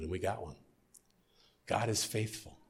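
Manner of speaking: ordinary delivery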